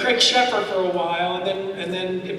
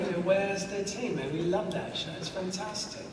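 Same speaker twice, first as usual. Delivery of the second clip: put-on English accent; 9 dB quieter than usual. English fan praising the show, in an English accent. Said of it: "he did it in the English accent".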